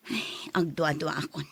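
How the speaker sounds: hushed, breathy aside; whisper. Acted. Mother whispering her worry about the big pay. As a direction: whisper your doubt to yourself. Mother voices doubt privately.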